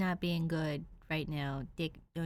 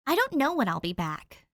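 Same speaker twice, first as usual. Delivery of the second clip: higher, louder sharp snap; snapping; 6 semitones higher, 5 dB louder than usual. Acted. Danny on the phone, refusing to give Dick a return date, snapping. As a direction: cut him off with a snap. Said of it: "Danny snaps".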